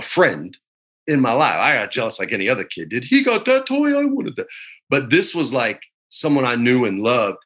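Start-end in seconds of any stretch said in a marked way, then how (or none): 3.0-4.5 put-on much higher kid voice, petulant; whiny kid. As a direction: imitate a jealous little kid. Mimics his childish younger self.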